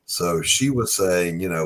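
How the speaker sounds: ordinary talk, nothing marked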